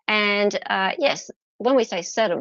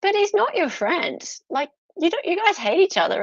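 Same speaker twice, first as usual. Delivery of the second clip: incredulous; 9 semitones higher than usual. Retold or imitated. Telling the barristers they hate each other, high and incredulous. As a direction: pitch way up, disbelieving protest. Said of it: "I'm like".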